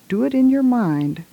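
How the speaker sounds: ordinary talk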